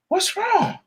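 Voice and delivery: high worried question. Retold or imitated. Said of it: "And he's like".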